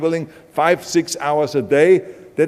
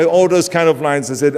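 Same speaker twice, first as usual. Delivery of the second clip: ordinary delivery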